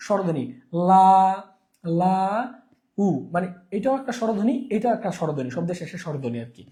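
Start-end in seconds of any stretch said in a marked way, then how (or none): 0.9-3.1 লা held long, then উ sounded apart; vowels sounded separately. Shown as a demonstration. Teacher showing two vowel sounds in a word, লা and উ separated. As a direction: stretch লা, pause, then give উ alone. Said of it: "মানে দুইটাই স্বরধ্বনি".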